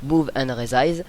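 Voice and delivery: slow English menu name, French accent. Real, not acted. Tutorial maker repeating the tool name, French-accented English. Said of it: "mon accent pourri en anglais".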